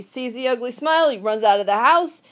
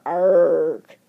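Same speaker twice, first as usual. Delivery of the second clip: low mock monster growl; 6 semitones lower than usual. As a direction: growl like the monster behind you. Teacher voices a monster.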